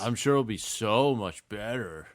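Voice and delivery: slow mock enthusiasm; sarcastic. Co-host as LeBron on playing with teammates, sarcastic. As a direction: draw out the praise with heavy irony. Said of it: "saying it sarcastically".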